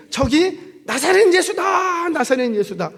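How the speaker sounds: high, drawn-out call, then easing; acted cry spreading news through a crowd; crowd shouting. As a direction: imitate an excited onlooker announcing someone. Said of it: "이렇게 막 소리를 질렀어요".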